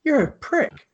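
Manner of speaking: high scornful retort; a snide jab at the bloke; mock insult. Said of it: "felt like turning around to him going".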